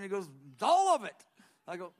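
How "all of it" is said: loud, high Arkansas accent impression; Bob Jones. Friend answering dream or vision, high loud Arkansas accent. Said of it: "That's my Arkansas accent".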